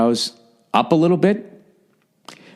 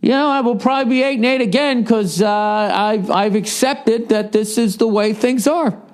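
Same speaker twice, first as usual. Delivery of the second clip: high-pitched mock voice of an all-accepting coach; resigned coach; 11 semitones higher than usual. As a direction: shrug off the losses in a high put-on voice. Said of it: "you're coaching a team, it'd be like".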